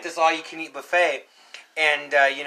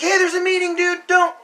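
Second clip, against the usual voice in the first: high, loud, panicky mock plea; imitated frightened plea; 6 dB louder than usual. Storyteller as himself facing the chainsaw, alarmed plea. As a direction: beg him off in a raised, scared voice. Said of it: "he scared the hell out of me".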